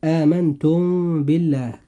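meem of آمنتم lightly hidden and held before ba; ikhfa shafawi on the meem of آمنتم. Shown as a demonstration. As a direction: hum the m of آمنتم into بالله. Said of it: "فننطق هاتين الكلمتين هكذا".

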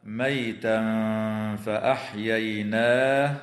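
slow recited "ميتا فأحييناه", tanween hidden in a nasal hold; ikhfa with ghunna. Shown as a demonstration. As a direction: hum the tanween of "ميتا" lightly into the ف. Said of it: "ويخفى التنوين عند الفاء مع الغنة مرققة". (إخفاء تنوين "ميتا" بغنة عند فاء "فأحييناه")

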